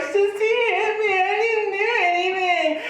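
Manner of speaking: put-on high, slow childish whine; whiny little girl. Seven-year-old girl telling her dad on her sister, whining high. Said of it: "she's like".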